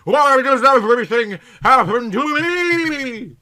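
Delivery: loud, high, melodramatic wail; exclaiming. Acted. Callie despairs loudly, a hammy high wail. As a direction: rail at the heavens, hammed up. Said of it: "she exclaimed".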